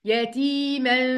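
"يتيما" slowly chanted, ending in a nasal hum; ikhfa with ghunna. Shown as a demonstration. Teacher repeating for students, "يتيما" slow with held ghunna. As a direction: draw out "يتيما" and hum the hidden nun. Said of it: "يلا ننطقها تاني".

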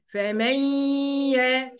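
"فمن يا" as fa-mayyy-ya, hummed through the nose; nasal ghunnah. Shown as a demonstration. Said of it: "بخنف كده في صوتي".

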